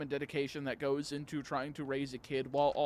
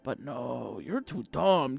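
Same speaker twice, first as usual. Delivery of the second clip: mocking; 8 semitones higher than usual. Mocking a gym leader.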